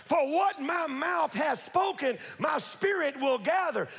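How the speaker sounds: ordinary delivery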